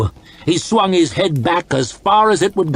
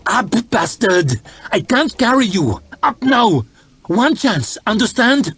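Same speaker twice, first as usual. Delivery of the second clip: high, strained hard voice; urgent harsh order; 8 semitones higher than usual. A narrator's reading. Rescuer hauling up a wounded man, harsh and urgent. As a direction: drive him up, pitched high and urgent.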